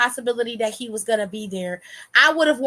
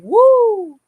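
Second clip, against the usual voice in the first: mock crowd woo; 10 semitones higher, 9 dB louder than usual. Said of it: "this video replaying is like".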